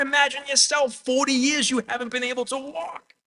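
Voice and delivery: high-pitched, excited exclamation; exultant preaching. Real, not acted. Preacher exulting over healed man leaping.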